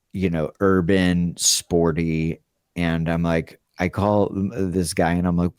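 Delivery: normal talking voice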